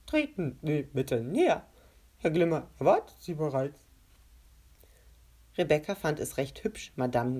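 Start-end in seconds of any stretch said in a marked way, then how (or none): 0.1-3.8 gurgling voice. A narrator's reading. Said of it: "seine Stimme klang glucksend".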